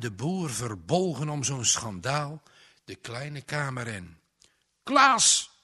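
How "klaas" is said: a curt, sharp, loud bark of the name; kortaf en snauwend. A narrator's reading. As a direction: snap the name short and hard. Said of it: "Kortaf en snarpend klinkt het". (kort, scherp geblaft)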